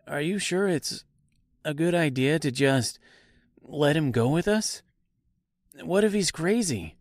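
high-pitched, worried character voice; wary aside. A narrator's reading. Scarecrow doubts stranger, aside to Dorothy.

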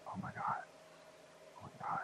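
breathless stunned whisper; hushed awestruck whisper; awed whisper. Retold or imitated. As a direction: whisper it in awe, barely audible. Hearing punk rock for first time.

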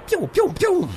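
high pew pew blaster noises; laser sound effects. Acted. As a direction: mimic laser fire. Imitating laser shots.

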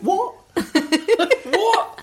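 ordinary talk

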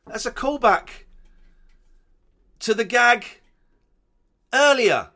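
high, loud, with pauses between bursts; excited shout. Real, not acted. Delighted at a callback gag.

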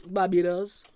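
brief attempt at a Bajan accent. Shown as a demonstration. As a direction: say it lightly in Bajan. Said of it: "your accents are quite good".

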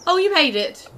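high, loud delighted hello; light up at the sight of a friend; excited greeting. Acted. Simon greeting Jeffrey at the tree, delighted.

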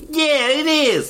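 high, hammed-up New York accent; exaggerated New York accent. Shown as a demonstration. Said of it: "That was my New York accent".

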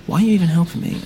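alarmed. Acted. Narrator confronts Nicola in fear.

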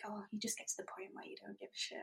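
soft, near-whispered quote of mum; unbothered mum, voiced very quietly; imitating mum. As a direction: drop to an offhand hush. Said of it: "She's just like".

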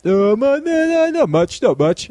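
imitating a friend dodging the question, lightly; playing coy. Retelling Ash dodging his question, mock coy. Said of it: "he's playing coy".

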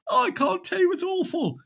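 very high, wailing woman's voice; hammed-up shocked barmaid; distressed barmaid. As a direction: barmaid just come round, wailing very high.

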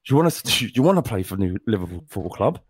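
low, growling threat; menacing threat. Acted. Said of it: "And he said".

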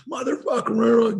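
mock high-pitched cursing outburst; erupting. Student cursing as his coach asks what's up, erupting. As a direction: imitate a teen bursting out, drawn out and high. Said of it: "And he erupts".

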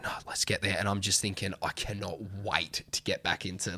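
soft, low, inner resolve; quiet determination. Retold or imitated. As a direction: murmur it to yourself.

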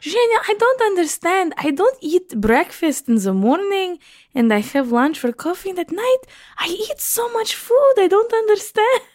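put-on high-pitched accented mum in protest; bewildered mum. Daughter imitating her mum who barely eats, high baffled protest. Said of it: "hers is".

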